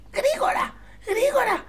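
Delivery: very high, loud panicked cry, slow on the last words; urgently hide the boy; frantic shout. Acted. Giant's wife urging Jack to hide in the oven, high panicked shout. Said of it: "φώναξε στο Τζακ".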